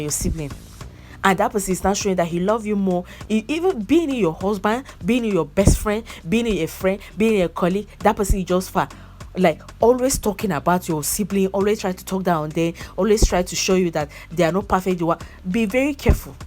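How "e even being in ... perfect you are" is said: normal talking voice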